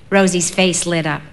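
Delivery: ordinary delivery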